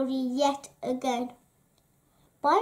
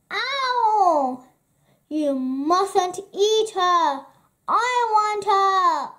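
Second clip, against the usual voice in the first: put-on high, loud, drawn-out childish demand; stretched-out protest, pitched high and loud; demanding shout; 6 semitones higher, 7 dB louder, slower than usual. A narrator's reading. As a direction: cry out like a bossy little robber girl. Robber girl bites, demands her way.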